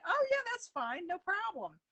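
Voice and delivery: bright, high, easygoing yes; cheerful reassurance. Retold or imitated. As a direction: reassure her it's no trouble. Retold cheerful reply to friend.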